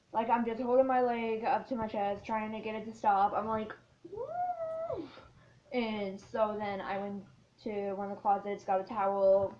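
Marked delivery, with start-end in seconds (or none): 4.1-5.1 a high, strained whoop of burning pain. Retold or imitated. Girl with a burning leg yelps in pain, high pained whoop. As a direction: yelp it out as the leg burns.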